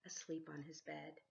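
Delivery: ordinary delivery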